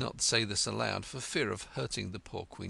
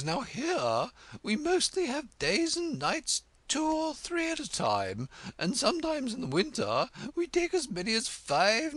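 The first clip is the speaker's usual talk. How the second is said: imperious Red Queen; 6 dB louder than usual. Acted. Red Queen testing Alice on French, high and haughty. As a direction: quiz her grandly, drawing out fiddle-de-dee.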